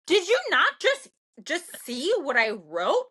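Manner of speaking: high, incredulous mock outburst; exasperated retort. Retorting to bullies who still came after her, exasperated. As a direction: throw it at them, exasperated and drawn out.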